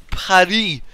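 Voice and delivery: "Paris" said the French way, silent s; French pronunciation. Shown as a demonstration. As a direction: say "Paris" as "pah-REE". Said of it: "Paris is how you people say".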